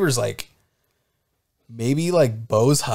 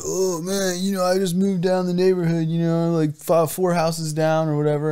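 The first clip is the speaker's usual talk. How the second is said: put-on higher-pitched, rambling drunk voice; drunk neighbor; 7 semitones higher than usual. Drunk man chatting to a dad over the fence, rambling drunkenly.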